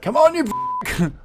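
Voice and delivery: high, drawn-out mock yell; slow, high-pitched parody of losing patience; imitating frustrated outburst. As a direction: mimic a gamer's exasperated cry. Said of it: "when you're just like".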